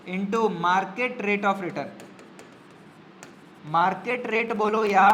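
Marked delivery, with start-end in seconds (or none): 0.1-1.9 drawn out word by word; slow dictation. Shown as a demonstration. Teacher dictating the normal profit formula, slow. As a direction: dictate it slowly for the notes. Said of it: "normal profits का formula क्या है?".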